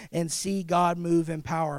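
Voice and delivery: low, soft and intimate; low, soft sermon close; hushed appeal. Real, not acted. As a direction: draw the room in close and quiet. Preacher's soft closing appeal.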